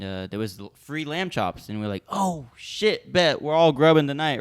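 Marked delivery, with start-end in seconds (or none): 2.1-4.4 higher, hyped-up group reaction; retold excitement. Friends reacting to free lamb chops, excited. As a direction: relive the thrill, voice lifted and louder.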